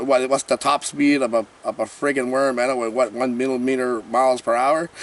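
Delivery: ordinary talk, nothing marked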